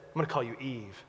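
a hint of Adam speaking softly to his wife; lightly voiced Adam, hushed and tender; preacher voices Adam renaming his wife, softly tender. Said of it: "he turns to her and he says".

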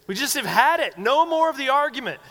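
high, loud end-of-patience scolding; mock exasperated parent. Parent ending the kids' argument, mock exasperated and loud. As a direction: play a parent who has had enough.

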